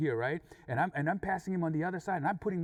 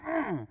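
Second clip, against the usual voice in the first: high, tight "mm"; mean-mugging grunt; 8 semitones higher, 4 dB louder than usual. Retold or imitated. Two drivers mean-mug each other, mean-mugging grunt. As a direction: hum a short, high, tough-guy grunt. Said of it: "we mean-mugged each other like".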